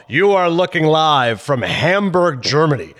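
slow, drawn-out broadcaster voice; Musburger impression. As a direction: call it like a grand old network announcer. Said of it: "imagine our man Musburger on the call".

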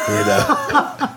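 loud, high burst of laughter. Real, not acted. Laughing loudly at his aunt's deathbed joke, real laughter.

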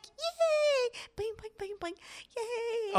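tiny high-pitched cheers and boings; marshmallow bouncing noise. Shown as a demonstration. Cohost demonstrates the sound of Clubhouse, tiny high squeaky boings. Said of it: "It sounds like".

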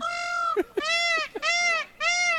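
shrill high peacock call; peacock impression. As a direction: screech like a peacock. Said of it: "my Peacock impression".

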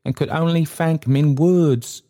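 high, humble character voice; hesitant, high-voiced confession; faltering. A narrator's reading. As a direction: apologise meekly, pitched well up. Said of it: "faltered Trotty".

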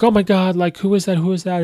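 high, startled disbelief; gasping at a stranger bursting in; shocked. Retold or imitated. Friends shocked by a stranger appearing.

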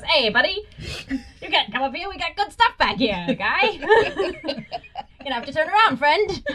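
a friendly Canadian "eh" lilt; Canadian accent. Retold or imitated. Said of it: "he goes".

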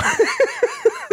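high, bright burst of laughter; real laugh. Real, not acted. Laughing after telling the Bruce joke, high laugh. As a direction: crack up at your own story.

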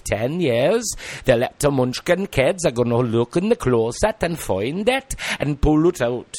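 lilting Welsh storyteller voice; Welsh accent. Shown as a demonstration. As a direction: tell it like a chatty Welshman. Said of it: "That's what I'm talking about with the Welsh dialect".

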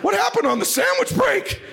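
very high-pitched baffled yell; incredulous shout. Retold or imitated. Onlookers baffled by the boy's play, incredulous high shout. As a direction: mimic stunned onlookers at the top of your range.

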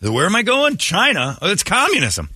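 high, indignant cartoon panda. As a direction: play an outraged panda who loves San Diego. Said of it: "said the panda".

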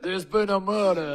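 put-on loud, slow, high Southern accent; Andy Bernard's Savannah accent. Teased about his impression, hammed-up Savannah accent. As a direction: ham up a drawn-out Savannah accent. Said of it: "Andy Bernard doing a Savannah accent".

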